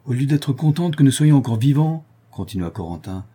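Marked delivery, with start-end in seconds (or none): 0.0-2.0 higher, louder aggrieved protest; hurt indignation at the women's sulk; indignant. Acted. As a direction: complain they should be glad. Corentin complaining to the women.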